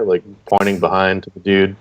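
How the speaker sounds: ordinary delivery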